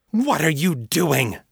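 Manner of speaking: high, outraged mother's cry; outraged demand. A narrator's reading. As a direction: confront him as you rush in. Mother rushes in, angry and afraid.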